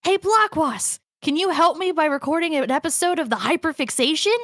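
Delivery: high, bouncy anime character; hammed-up anime skit delivery. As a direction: put on a high-pitched anime voice. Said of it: "Anime voice".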